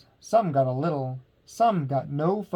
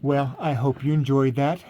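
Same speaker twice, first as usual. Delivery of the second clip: ordinary delivery, nothing marked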